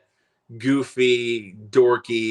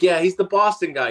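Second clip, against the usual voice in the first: tag; 8 semitones higher, 4 dB louder than usual. Retold or imitated. Retold guy insisting on Boston label.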